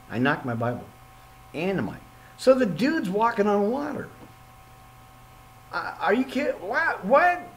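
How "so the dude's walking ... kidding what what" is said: higher disbelieving outburst; incredulous, rising; amazed. Real, not acted. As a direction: react in astonished disbelief. Amazed at Jesus walking on water.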